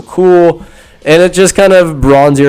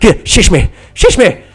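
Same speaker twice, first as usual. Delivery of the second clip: mock frightened sidekick; 5 semitones higher than usual. Voices Benny fending off a beetle.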